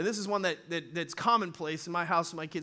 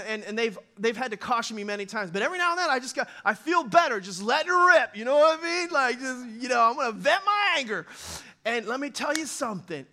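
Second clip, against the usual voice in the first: usual speaking voice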